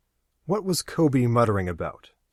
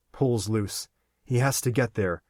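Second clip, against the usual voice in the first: ordinary delivery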